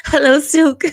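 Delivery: greeting through a laugh; laughing hello. Real, not acted.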